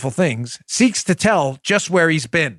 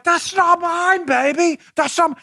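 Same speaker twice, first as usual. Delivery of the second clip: high, drawn-out comic denial; mock-indignant and high; Austin Powers; 10 semitones higher, slower than usual. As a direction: protest slowly in a silly high voice. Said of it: "the analogy of the Austin Powers".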